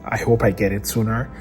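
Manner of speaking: hushed late-night voice; quiet at night. Real, not acted. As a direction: keep it low, someone's sleeping. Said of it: "I'm a bit quiet because my wife is asleep".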